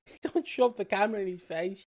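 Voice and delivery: normal talking voice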